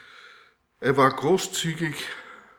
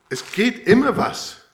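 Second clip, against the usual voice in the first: excited exclamation; 8 semitones higher, 7 dB louder than usual. Real, not acted. Pouring more into the measure.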